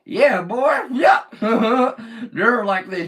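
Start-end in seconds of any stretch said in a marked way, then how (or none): 0.0-1.2 loud, high Southern holler; Southern US accent. Retold or imitated. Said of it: "they talk totally different". 1.3-2.3 loud burst of laughter; big hearty laugh. Real, not acted. Laughs after Southern imitation.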